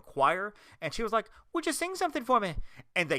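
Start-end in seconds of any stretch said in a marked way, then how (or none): 1.5-2.6 high-pitched bad Scottish accent; Merida, Scottish accent. Princess asking a choir to sing, mock Scottish accent. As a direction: put on a lilting mock-Scottish voice. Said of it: "Terrible Scottish accent".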